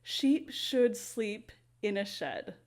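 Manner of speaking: very slow, careful delivery of each word; slow tongue twister. Shown as a demonstration. Teacher reading a tongue twister to viewers, very slowly. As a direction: take it slow so every sound is clean. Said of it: "I was saying it quite slowly".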